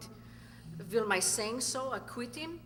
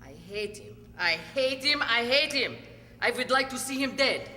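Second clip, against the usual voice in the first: from cold to loud and higher; building hatred; 4 semitones higher, 7 dB louder than usual. Acted. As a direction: let the hatred swell each time. Wife declares hatred, building.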